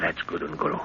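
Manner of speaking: hushed whisper, barely voiced. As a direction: breathe the words so guards won't hear. Sneaking out at night.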